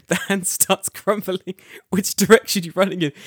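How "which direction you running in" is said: higher and louder, pressing hard; loud demanding question. Retold or imitated. Repeating the game question to a friend, loud and urgent. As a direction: demand an answer right now.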